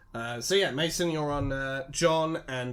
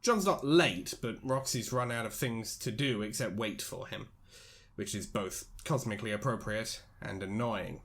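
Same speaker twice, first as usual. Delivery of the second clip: measured, even storyteller reading; story narration; 5 semitones lower, 8 dB quieter than usual. A narrator's reading. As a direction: read it like a novel's narrator.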